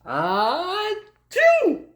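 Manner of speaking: loud high hammed-up sneeze; big sneeze. Reading the bear waking up, a loud acted sneeze. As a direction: blast out a cartoon sneeze. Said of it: "He blows and he sneezes".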